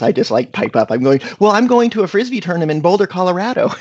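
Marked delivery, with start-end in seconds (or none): 1.4-3.7 high, fast nervous answer; nervous teen to the cop, high and fast; nervous blurt. Retold or imitated. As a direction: rush it out much higher, like a scared kid. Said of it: "And I'm so nervous".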